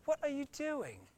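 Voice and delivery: high, laughing mock landlady; amused imitation of the startled landlady. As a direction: squeak it like the woken lady. Angry landlady woken at night.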